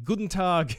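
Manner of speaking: mock German accent from a drunk Brit. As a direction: greet the crowd in a hammed-up German accent. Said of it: "German accent".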